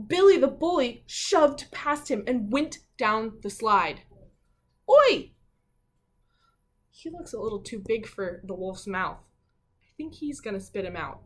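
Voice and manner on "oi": higher, much louder cry of protest; shout. Acted. Preston shouting at Billy the bully, much higher and louder. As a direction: yell at the bully.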